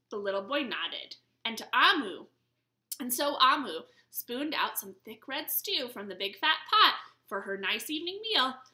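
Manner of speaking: ordinary delivery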